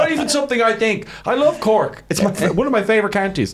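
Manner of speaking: high-pitched earnest protest, like a proud Corkman; Roy Keane-like. Real, not acted. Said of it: "It sounded like Roy Keane".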